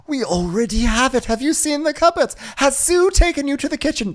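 very high, put-on chirping questions; mock gushing princess. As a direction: gush hammily about the castle pantry. Princess gushing to knight about castle.

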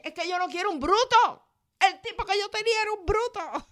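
high, fast complaining woman's voice; high and fast, sick of her ex; fed-up woman. Retold or imitated. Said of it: "¿O qué tal la que dice".

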